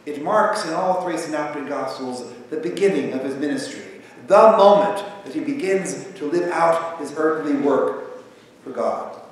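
usual speaking voice

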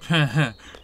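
short, loud giggle; acted chuckle. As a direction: burst into a brief teacherly laugh. Voices teacher's laugh in game.

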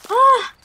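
high, loud yelp of fright; short startled "ah". Real, not acted. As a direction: yelp as if jumped at. Scared while playing horror game.

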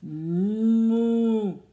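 the cow scaring the farmer; loud moo. A narrator's reading. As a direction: bellow like a cow. Cow's big moo.

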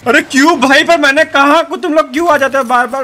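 loud, high-pitched protest; exasperated shouting. Real, not acted. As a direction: shout in exasperation at repeat attackers. Protests at attackers coming back repeatedly.

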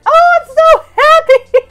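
high, loud laughing delight; giddy with happiness; real laughing glee; laughing joy. Real, not acted. Thrilled at find.

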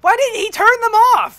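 loud high yell; panicked shout. Real, not acted. Panic at turrets.

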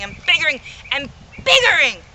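rising to a loud boast; building rant. Acted. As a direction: swell into a shout.

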